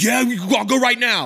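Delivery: high, loud eager reply; eager yes. Retold or imitated. [name] jumping at the Iraq tour, high and eager. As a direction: jump at the chance, overeager.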